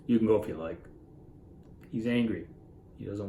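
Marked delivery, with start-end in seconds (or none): none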